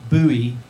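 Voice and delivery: "Bowie" with OO as in boot, stretched and loud; slow, emphatic "Bowie" starting like "boo". Shown as a demonstration. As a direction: say "Bowie" to rhyme with gooey. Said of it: "the [name] option".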